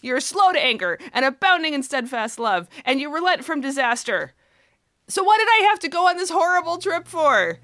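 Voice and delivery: building from mock grievance to an indignant outburst; mock-furious complaint that rises into a loud, high protest; furious accusation. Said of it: "this tone of furious accusation against God".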